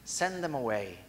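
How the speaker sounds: soft, low, reflective repeat; a hushed echo of the dismissal; quiet echo. Acted. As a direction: let the phrase fall away quietly. Repeating the disciples' phrase.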